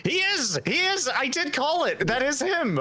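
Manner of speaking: high excited outburst; thrilled shout of recognition. Real, not acted. Recognizes voice actor.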